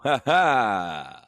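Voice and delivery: short triumphant "ha ha"; gloating laugh. Real, not acted. Trader laughing at slide-selling rivals, gloating.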